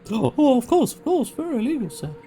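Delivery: high, put-on flustered voice; gasp, then squeak out a hasty agreement; flustered villager. Villager assuring the visitor it is illegal, flustered.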